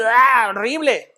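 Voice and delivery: a drawn-out groan sliding into the word; acted gut-wrench. Preacher showing how accusation feels in the stomach, drawn-out groan. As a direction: act out a sick feeling, slowly. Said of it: "En el estómago sientes".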